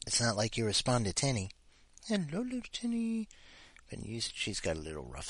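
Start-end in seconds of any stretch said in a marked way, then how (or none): 2.1-3.3 high, soft cooing. Real, not acted. Owner cooing softly to her pet. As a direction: coo to the little dog.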